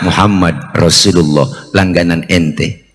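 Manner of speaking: low and steady; calm low answer. Retold or imitated. The Prophet names himself at the sick man's door, low and calm. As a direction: say your name low and patient.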